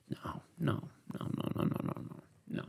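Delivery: soft, breathless string of no's; half-whispered no, no, no; hushed scolding. Acted. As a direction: shush a misbehaving dog under your breath. Scolding friend like a dog.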